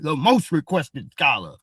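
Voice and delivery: the same brag, voiced a bit further from own voice; lightly put-on pompous scholar voice; mock boastful scholar. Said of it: "That's what you sound like".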